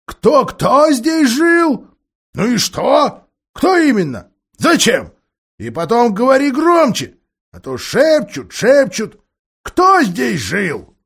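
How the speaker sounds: high, loud, slow querulous dwarf; deaf old dwarf. A narrator's reading.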